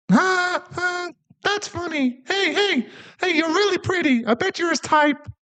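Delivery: very high, eager puppy voice; excited dog boy.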